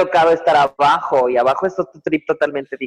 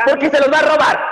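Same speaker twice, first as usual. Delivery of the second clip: teasing shout; 13 semitones higher, 3 dB louder, faster than usual. Real, not acted. Teasing friend about stealing babies.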